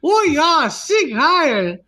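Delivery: high, loud hammed-up German; mock German accent. Clowning along with the co-hosts' jibe, high mock-German rant. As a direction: parody a ranting German villain. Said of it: "German accent".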